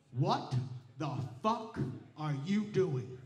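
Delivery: low, slow gruff husband; husband's voice. Retold or imitated. Husband finding him playing with a raccoon, low gruff disbelief. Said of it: "looks at me ... and says".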